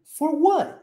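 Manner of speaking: For what? ending with no T; swallowed T. Shown as a demonstration. Teacher shows a swallowed final T in For what?. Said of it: "Engoliu o T".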